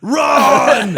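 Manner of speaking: high, very loud mock scream; imitated shouted warning. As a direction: bellow it as a comic sudden warning. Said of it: "he just goes".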